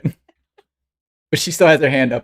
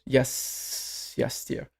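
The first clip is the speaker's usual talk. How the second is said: very soft, barely voiced reply; weary dad; 12 dB quieter, much slower than usual. Dad answers his chattering daughter, hushed and resigned. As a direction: give in quietly, like a worn-out father. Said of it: "And Carl goes".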